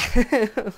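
short burst of loud, high laughing; rueful laugh. Real, not acted. Player laughing over hours of digging, rueful laugh. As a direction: laugh at your own suffering.

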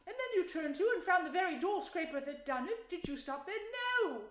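high, loud, gushing Mole voice; pitched right up, loud and exclaiming; excited storybook Mole. Acted. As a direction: play a small animal gushing with admiration.

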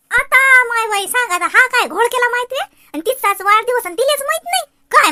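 loud, high-pitched disbelief; astonished scolding. Acted. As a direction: react loudly to a baffling muddle. Aunt astonished at secret party. (अचंबित होऊन मोठ्या, चढ्या आवाजात)

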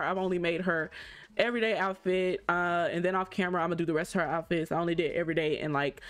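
normal talking voice

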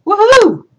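high, loud whoop; celebratory cheer. Pretend. Teacher cheering the highest-score rule, excited whoop. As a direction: let out a happy woohoo.

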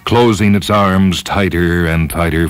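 ordinary delivery, nothing marked

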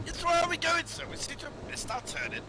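high put-on upper-class English voice; a stuffy English gent fretting; posh English accent. Two fine English gentlemen.

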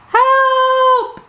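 high, panicky call for help; crying for help. Acted. Peppa falling off the sled, crying for help. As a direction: yell for help while falling off. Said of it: "she cried".

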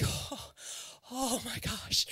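breathy, worn-out whisper; exasperated whisper. Retold or imitated. Tired mom whispering over the endless spoon feeding. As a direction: sigh it out like a tired parent. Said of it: "I was like".

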